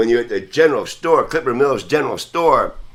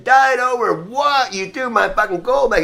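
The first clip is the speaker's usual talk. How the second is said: indignant outrage; 10 semitones higher than usual. Retold or imitated. To the old man who threw back his gold, outraged protest. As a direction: stretch out the outrage, pitched way up. Retelling the fight over gold.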